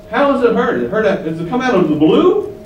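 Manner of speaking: ordinary delivery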